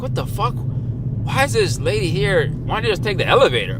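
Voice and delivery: baffled, can't believe what you're seeing; exasperated disbelief. Retold or imitated. Baffled by mother at escalator.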